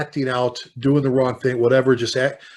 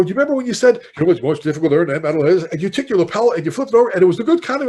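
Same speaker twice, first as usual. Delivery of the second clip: higher, louder and fast, tumbling over the words; excited gushing; 5 semitones higher, 6 dB louder, faster than usual. Retold or imitated. Retelling meeting a hero on the plane, excited fast gush. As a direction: starstruck rush, words spilling out.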